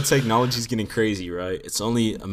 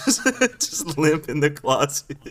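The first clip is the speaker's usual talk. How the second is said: laughing; 5 semitones higher than usual. Real, not acted. Laughing at the robot image.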